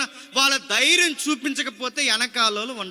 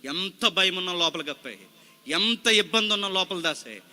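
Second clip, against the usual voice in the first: soft, low consoling plea to a grieving brother; sister consoling; 10 semitones lower, 4 dB quieter than usual. Retold or imitated. Sister gently urging her brother not to cry. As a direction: gently tell him not to cry, a touch hushed. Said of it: "Priyanka Gandhi ఇలా అంది".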